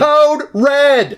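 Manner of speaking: high, loud mock emergency call; playful red alert shout; mock alarm. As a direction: sound the alarm in jest.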